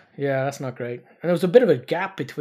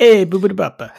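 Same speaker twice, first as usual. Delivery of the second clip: hammed-up fake Italian accent, higher and louder; mock Italian accent; 7 semitones higher, 8 dB louder than usual. Joking about faking Finnish, fake Italian accent. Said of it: "you have to do a fake Italian accent".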